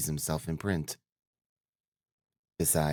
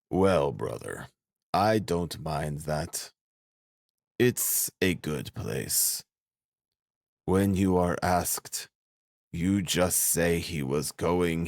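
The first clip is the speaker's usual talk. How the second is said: slow, deliberate character voice with long pauses; Svidrigailov, unperturbed; slower than usual. A narrator's reading. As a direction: take your time, resigned to it. Narrator voices Svidrigailov before suicide.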